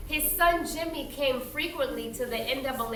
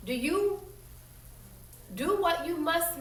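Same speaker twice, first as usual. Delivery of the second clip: ordinary delivery, nothing marked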